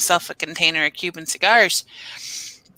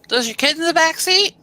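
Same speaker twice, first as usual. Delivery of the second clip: suspicious guard; 9 semitones higher, 3 dB louder than usual. Retold or imitated. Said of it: "they're like".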